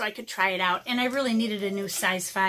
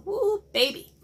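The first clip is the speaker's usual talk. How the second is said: much higher excited whoop; real delighted woo; 10 semitones higher, 3 dB louder than usual. Real, not acted. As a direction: cheer at the fine point. Admires brush point.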